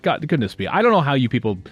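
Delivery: ordinary talk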